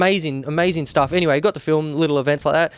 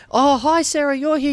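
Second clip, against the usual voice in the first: much higher, delighted hello; pitched-up gushing welcome; excited greeting; 10 semitones higher than usual. Retold or imitated. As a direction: act out a happy surprise greeting. Singer greeting a friend.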